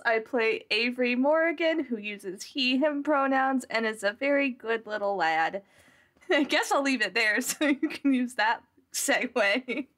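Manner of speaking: ordinary talk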